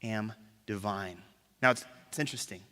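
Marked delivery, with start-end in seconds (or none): 0.0-1.2 low hushed claim. Retold or imitated. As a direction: lower and softer, slow on am divine. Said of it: "him saying".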